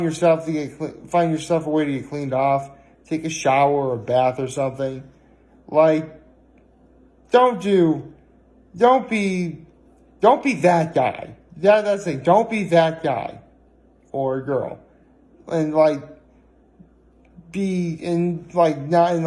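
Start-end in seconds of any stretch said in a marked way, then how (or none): none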